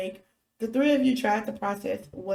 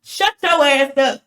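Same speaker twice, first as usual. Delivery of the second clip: shouting, slow and much louder; 6 semitones higher, 12 dB louder, slower than usual. Real, not acted. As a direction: yell it at him. Yelling at a character.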